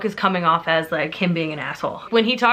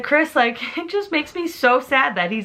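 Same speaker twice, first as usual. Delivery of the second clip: choked-up retelling; 6 semitones higher than usual. Retold or imitated. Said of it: "getting choked up about it last night".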